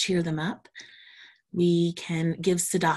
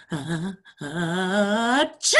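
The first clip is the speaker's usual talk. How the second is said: slow building sneeze; drawn-out sneeze; 3 dB louder, much slower than usual. Acted. Reading the story, acting out Josh's second sneeze. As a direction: stretch the ah before the achoo.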